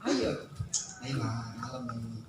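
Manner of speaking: low, soft and slow come-on; a sly hushed "ayo malam ini"; coaxing low murmur. Retold or imitated. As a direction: murmur it like a secret invitation to your spouse. Mimics a flirty invitation to a spouse.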